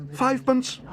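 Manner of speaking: very high, loud eager bid; excited cry. A narrator's reading. Paddington bidding for the marmalade stand, crying excitedly. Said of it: "cried Paddington excitedly".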